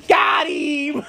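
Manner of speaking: loud, gleeful, laughing shout; happy Got him. Retold or imitated. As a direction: shout it with pure delight. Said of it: "He's so happy, though, when he says it".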